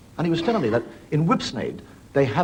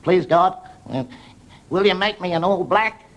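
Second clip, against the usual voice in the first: put-on high, pleading child voice, slowed; little New Zealand boy praying to God; 7 semitones higher, 4 dB louder, slower than usual. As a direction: beg like a small boy. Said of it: "boy goes and prays to God".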